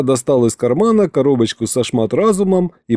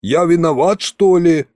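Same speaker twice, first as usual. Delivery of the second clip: mildly indignant, higher-pitched protest; 5 semitones higher than usual. A narrator's reading. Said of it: "возмутился Шматразум". (с лёгким возмущением, повыше)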